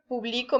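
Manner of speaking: publico stressed pu-BLI-co; pu-BLI-co, present-tense stress; stress on middle syllable. Shown as a demonstration. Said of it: "no lleva acento".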